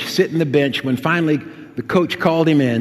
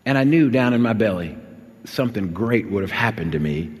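normal talking voice

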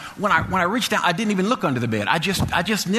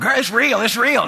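high, loud mock phone excitement; excited gushing; 8 semitones higher, 5 dB louder than usual. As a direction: imitate a mama amazed, telling her girlfriend. Retold mama excited to girlfriend.

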